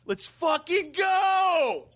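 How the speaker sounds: high, drawn-out fired-up shout; sideline coach yell. Acted. Pumping up the team from the sidelines, high drawn-out shout. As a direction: bellow it like a hyped sideline guy. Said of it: "This coach character that just goes".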